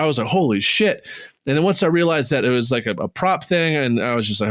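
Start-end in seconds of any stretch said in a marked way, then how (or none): 0.3-1.1 higher-pitched replayed disbelief; raised, perturbed exclamation in retelling; retold perturbed shock. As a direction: recall being badly rattled. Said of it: "I was so perturbed".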